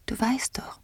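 high, very soft whisper; delicate, airy empress voice; sweet voice. A narrator's reading. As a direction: speak sweetly, barely above a breath. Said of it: "hörte er die süße Stimme".

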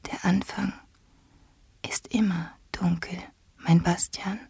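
soft, airy half-whisper; hushed, delicate empress voice; tender as a bird. A narrator's reading. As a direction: breathe it gently and soothingly. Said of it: "die vogelzarte Stimme".

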